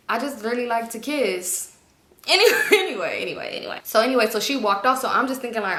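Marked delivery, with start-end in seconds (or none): 2.2-3.2 loud high laugh through the words. Real, not acted. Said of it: "laughing, then cutting herself off". 3.2-3.8 quick unvoiced whisper of anyway. Real, not acted. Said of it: "whispered after the laugh".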